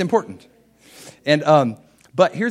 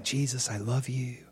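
soft, hushed devotion to Jesus; murmured prayer, acted out; quiet prayer; 12 dB quieter than usual. Husband praying to Jesus in his office, quiet prayer.

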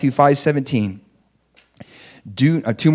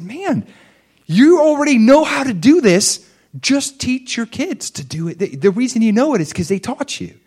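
excited; 12 semitones higher, 4 dB louder than usual. Retold or imitated. Urging a woman to teach kids.

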